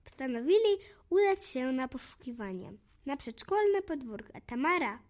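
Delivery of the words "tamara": usual speaking voice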